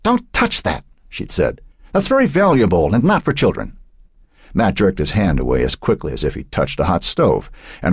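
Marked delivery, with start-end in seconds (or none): 0.0-0.8 put-on high, sharp scolding old woman; chiding grandmother. A narrator's reading. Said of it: "her mother chiding Matt". 1.9-3.8 high, prim scolding; chiding grandmother. A narrator's reading. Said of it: "her mother chiding Matt".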